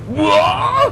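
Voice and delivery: shocked what. Acted. Onsen shrieks on hearing her destination. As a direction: shriek in disbelief. Onsen hears Maison Ikoku.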